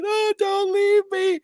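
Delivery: mock pleading whine. Streamer mimicking the mother begging the man, whiny. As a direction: plead like a faked sob. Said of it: "she goes back to".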